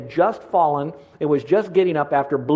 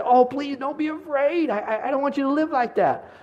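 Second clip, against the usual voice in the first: pleading pastor; 9 semitones higher, 3 dB quieter than usual. Retold or imitated. Pastor gently begging his scared congregation not to fear, soft high plea. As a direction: coax them gently out of fear like a caring pastor. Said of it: "I feel more like a pastor".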